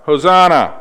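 loud, high acclamation; crying out. Acted. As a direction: cry it out to greet a king. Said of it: "and cried out".

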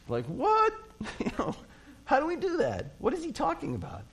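high, baffled "what?" with a laugh; mock bewilderment. Preacher playing a confused crowd, high baffled voice. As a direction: squeak out the confusion.

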